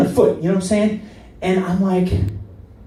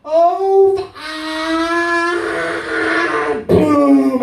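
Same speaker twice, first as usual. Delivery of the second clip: high, drawn-out crash wail; long high "oh, ah" ending in a boom; slow-mo moment; 12 semitones higher, much slower than usual. Retold or imitated. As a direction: stretch it like slow motion into the impact. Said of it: "you do the slow-mo moment".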